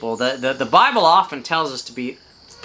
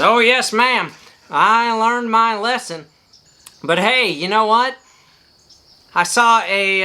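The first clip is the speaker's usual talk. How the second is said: cheeky parrot; 6 semitones higher than usual. Acted. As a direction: play the reformed parrot, pleased with itself. Parrot answers the lady.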